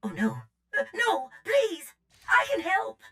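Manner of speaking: desperate pleading. Acted. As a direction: plead not to be abandoned. Droid begs not to be left.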